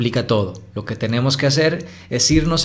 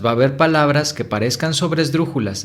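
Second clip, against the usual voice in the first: ordinary talk, nothing marked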